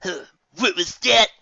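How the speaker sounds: loud, slow, challenging; confrontational. Acted. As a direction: square up to them. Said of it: "he asked confrontationally".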